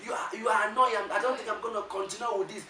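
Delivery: heated, high-pitched mock rant; angry shouting. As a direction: act out flaring up at your partner. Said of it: "Baby, you are shouting".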